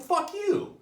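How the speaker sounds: high, loud comic outburst; angry retort. Acted. Patient angry at his therapist, high angry retort. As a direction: fire it back in squeaky indignation. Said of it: "That kinda gets me angry".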